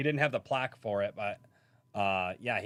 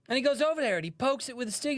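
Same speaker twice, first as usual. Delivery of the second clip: higher, excited Australian narration; Australian comic's voice; 4 dB louder, faster than usual. Retold or imitated. Streamer retells the comic narrating Irwin, higher excited Australian. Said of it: "Steve Irwin bit".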